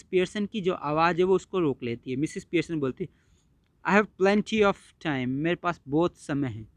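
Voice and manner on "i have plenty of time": slow cool mother. Acted. Mother's cool reply to son.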